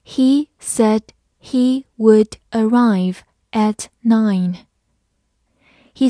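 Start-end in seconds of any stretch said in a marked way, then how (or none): 0.0-4.6 slow, careful word-by-word read. Shown as a demonstration. Teacher reading slowly for students. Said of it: "慢慢来读".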